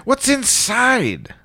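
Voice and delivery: high, slow gentle question; high slow coaxing. Acted. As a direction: coax it out slowly.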